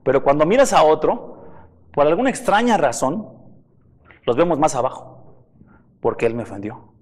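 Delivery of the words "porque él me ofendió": low, soft muttering of a wronged believer; muttered grudge. Retold or imitated. Preacher voicing a resentful believer, low muttered grudge. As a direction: grumble it under your breath.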